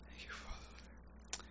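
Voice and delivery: breathy, slow whisper of thanks; whispered prayer. Real, not acted. Closing a prayer to the Father in a hushed, slow whisper. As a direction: whisper it reverently, very slow and soft.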